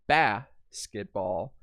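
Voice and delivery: basketball broken slowly, leaning on BAS; first syllable stressed. Shown as a demonstration. Teacher marks the accented syllable, stressed BAS in basketball. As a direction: draw out a strong BAS in basketball. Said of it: "accent mark over the first syllable".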